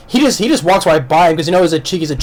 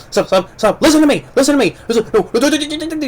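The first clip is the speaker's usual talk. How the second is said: high, frantic begging, pitch climbing higher with each no; desperate pleading; 11 semitones higher, faster than usual. Retold or imitated. Dying soldier pleads with his killer, high panicked begging. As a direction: beg for your life as the knife goes in. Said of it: "He's like".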